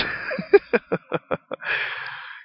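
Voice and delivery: airy high giggle; breathy laugh. Real, not acted. Laughing at his own joke, breathy high giggle.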